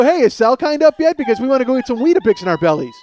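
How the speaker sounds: loud, high, excited call; eager rats. Acted. Rats asking after the squirrel act, eager shouting. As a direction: shout it like a hungry rat mob.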